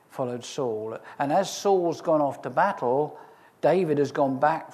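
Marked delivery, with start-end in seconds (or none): none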